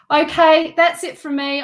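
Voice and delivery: cheerful outro burst; bright loud sign-off. Real, not acted. Signing off.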